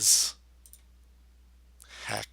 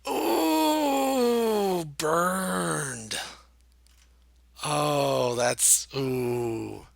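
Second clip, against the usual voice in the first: pained wail; 5 semitones higher, 4 dB louder, much slower than usual. Real, not acted. Reacting to a badly wrong guess.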